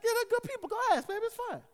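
very high, loud old-lady impression. Old neighbor urging pastor to go ask, old lady voice. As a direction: shrug off worry in a high elderly voice. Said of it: "so it's my old lady voice".

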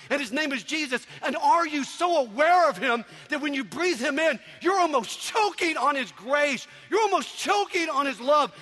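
high, driving preacher's cry; fervent preaching. Real, not acted. Preacher urging congregation to really encounter God, fervent preaching. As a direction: preach it full force, building to a shout.

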